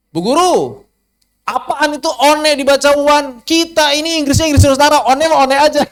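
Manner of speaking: high, loud indignant pupil voice; mock protesting pupil. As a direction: whine at the teacher in a high, loud voice. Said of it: "protes sama guru bahasa Inggris". (murid memprotes bu guru, suara tinggi dan keras)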